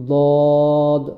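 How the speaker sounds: "দদ" heavy and long, tongue edge against upper teeth; thick dad. Shown as a demonstration. Teacher demonstrating the letter dad, thick and long-drawn. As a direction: hold "দদ" out with a full, heavy D. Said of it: "দদ বলার সময়".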